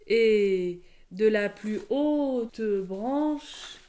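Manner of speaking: very slow, suspenseful stretching; drawn-out narration. A narrator's reading. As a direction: linger on each word up to "branche".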